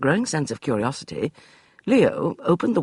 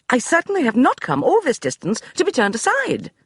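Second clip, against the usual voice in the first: high, brisk dismissal; imperious lady; commanding lady; 14 semitones higher, 5 dB louder than usual. Acted. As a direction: brush him aside like a general. Beatrice voiced.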